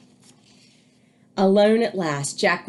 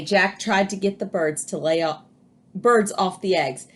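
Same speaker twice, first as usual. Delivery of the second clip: usual speaking voice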